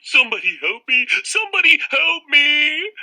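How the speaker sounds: very high, loud screaming plea; panicked cry for help. Acted. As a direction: scream for rescue in terror. Boy fleeing vampire.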